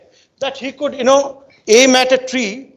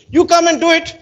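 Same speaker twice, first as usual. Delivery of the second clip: raised, higher call across a gap; 7 semitones higher, 4 dB louder than usual. Retold or imitated. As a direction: summon him from the far side. Master calls student across chasm.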